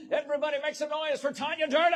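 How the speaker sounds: loud, high hype introduction; rally the crowd like an emcee; announcer shout. Real, not acted. Introducing next speaker to crowd.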